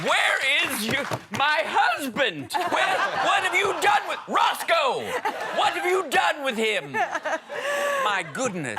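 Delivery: put-on very high, strained old man voice. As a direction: play a lost, fretful grandpa. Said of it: "even more attracted with the old man voice".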